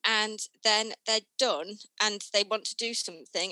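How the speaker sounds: ordinary delivery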